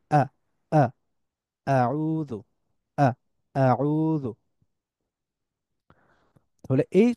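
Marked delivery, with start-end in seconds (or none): 0.1-4.3 short sudden আ, then drawn-out আউযু; isolated hard-onset আ leading into chanted আউযু; abrupt hamza drill. Shown as a demonstration. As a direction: catch the throat before each আ. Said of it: "এরকম শুরু হয়".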